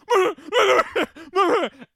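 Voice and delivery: loud, high laugh; high burst of laughter. Real, not acted. Laughing at his own story.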